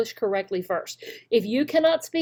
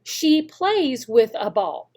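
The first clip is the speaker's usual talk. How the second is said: slow model sentence; slower than usual. Shown as a demonstration. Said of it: "Do we want".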